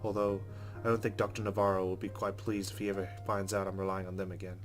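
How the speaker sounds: usual speaking voice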